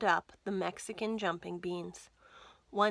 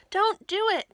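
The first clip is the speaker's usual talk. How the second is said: much higher, louder alarmed plea; cried out; 12 semitones higher, 8 dB louder than usual. Acted. Martha crying out to stop George jumping. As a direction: cry out to stop him. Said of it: "cried Martha".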